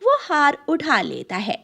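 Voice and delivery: ordinary delivery, nothing marked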